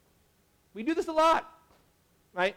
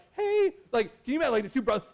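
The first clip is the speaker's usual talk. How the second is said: mom butting in; 5 semitones higher, faster than usual. Retold or imitated. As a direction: play the pushy mother calling out. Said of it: "mom has to be like".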